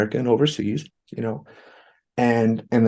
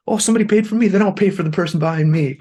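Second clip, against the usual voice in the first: high, louder, delighted imitation; excited quote; 9 semitones higher, 4 dB louder, faster than usual. Customer deciding to pay it forward, excited. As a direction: act out a happy realization, pitched up.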